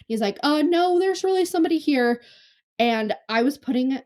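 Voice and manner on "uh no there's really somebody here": high, sing-out imitation of a dad; older dad voice. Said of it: "like, dad voice".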